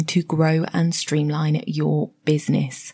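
loud, upbeat announcer read of the show intro; podcast intro read. Acted. Host opening her own podcast, upbeat announcer voice. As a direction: sell the show to new listeners.

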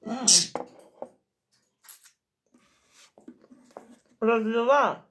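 chewing while talking; talking between bites; mouth full. Real, not acted. Seen 0: Puts cookie pieces to mouth at 1.5 and 5.0, chews while talking.

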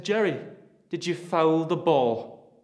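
lightly voiced father, slow and accusing; mocking father's question. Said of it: "and you said".

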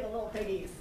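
ordinary delivery

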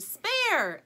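high, loud shout; yell. A narrator's reading. Adults yell at the bowling alley, loud. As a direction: call it out across the lanes. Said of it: "The adults yell".

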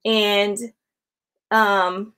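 normal talking voice

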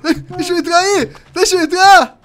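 high desperate shouting; being chased. Real, not acted. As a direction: yell to be let in, panicking. Begging to get into hiding spot.

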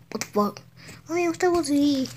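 ordinary delivery

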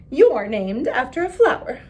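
mocking. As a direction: sneer at her name.

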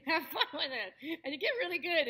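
normal talking voice

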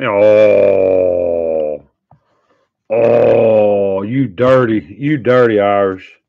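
loud, playful accusing call-out; loud mock outrage. Real, not acted. Ribbing a friend in the stream, loud mock outrage. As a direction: call him out loud in fun.

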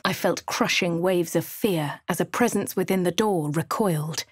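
normal talking voice

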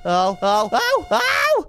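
high, climbing "oh" squeals; rising alarmed cries. Acted. Playing the scare as the monster appears, rising yelps. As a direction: build repeated yelps into falsetto. Reacting to the scared character's playback.

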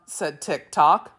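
plain reading voice

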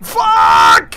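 real drawn-out yell of alarm; frustrated shout. Real, not acted. Player in trouble in game, shouted curse. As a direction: yell it out as things go wrong. Swears as game goes wrong.

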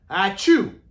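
loud mock sneeze achoo; hammed-up sneeze for the kids; acted sneeze. Teacher acting out Amos waking with a sneeze, playful loud sneeze.

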